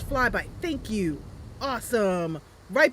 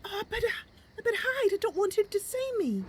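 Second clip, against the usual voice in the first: put-on a small elf girl spotting a dragon overhead and scrambling to hide; Mercy frightened; 11 semitones higher than usual. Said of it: "I am voicing this character".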